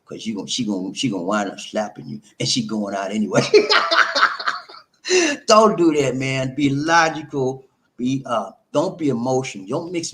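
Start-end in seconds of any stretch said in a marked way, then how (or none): 3.5-4.8 squeaky breathy laugh; real amused laugh; high laugh. Real, not acted. Laughs at his own joke.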